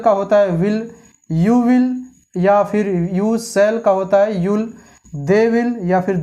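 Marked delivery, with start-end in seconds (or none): none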